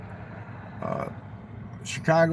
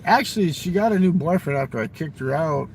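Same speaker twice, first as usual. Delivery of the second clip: normal talking voice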